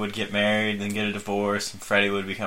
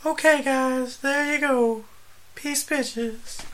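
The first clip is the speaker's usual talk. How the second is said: very high, airy lady voice; 3 dB quieter, much slower than usual. Pretend. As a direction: read it as a breathy lady. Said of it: "Let me read it like a lady".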